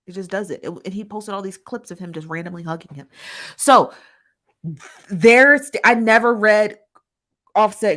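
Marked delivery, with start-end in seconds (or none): 3.6-7.9 louder emphatic restart. Real, not acted.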